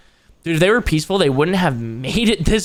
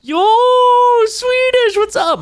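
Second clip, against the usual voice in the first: excited greeting; 5 dB louder than usual. Real, not acted. Greeting a chat viewer.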